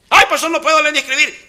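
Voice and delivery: fast, high whining Moses; whiny excuse. Acted. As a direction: plead off the job in a hurry. Moisés voiced with excuses. (Moisés quejumbroso y rápido)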